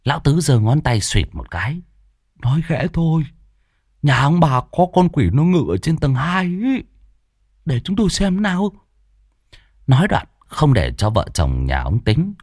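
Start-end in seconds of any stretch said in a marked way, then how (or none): 2.4-8.7 put-on higher, hushed old man's voice; hushed higher-pitched old exorcist. Lão Tứ speaks in story.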